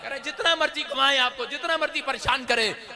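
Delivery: insistent, building sermon cry; full-force preaching. Real, not acted. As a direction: preach it loud to the congregation.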